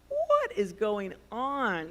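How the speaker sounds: slow, drawn-out bewilderment; bewildered. Acted.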